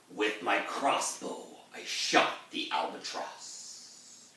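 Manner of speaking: slow breathy whisper; hushed confession. Acted. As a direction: whisper it slowly, like a dark secret. Mariner's grim confession.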